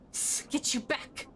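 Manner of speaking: breathy unvoiced hiss, slow; feral hiss. Scarred woman hisses the stranger back from her. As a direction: spit it out like a cornered animal.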